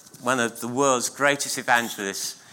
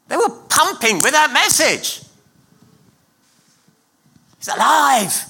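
higher, louder, fast rousing preaching; full-force preaching; 9 semitones higher, 4 dB louder than usual. Real, not acted. Preacher proclaiming the resurrection to the church, at full force. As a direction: fire up the congregation.

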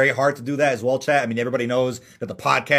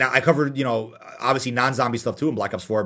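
normal talking voice

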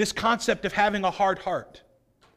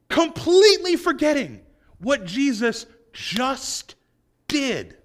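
slow emphatic; 6 semitones higher, 4 dB louder, slower than usual. Acted. Preacher stresses disciples' forgetfulness.

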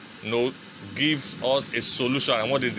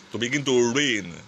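normal talking voice

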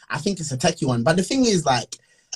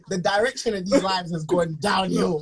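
usual speaking voice

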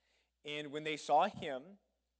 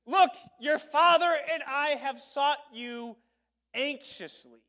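high, loud, slow reproach; upset mother; 11 semitones higher, 13 dB louder, slower than usual. Acted. Mary rebukes the boy Jesus, loud high reproach. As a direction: drive home how worried she was. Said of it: "she let everybody know".

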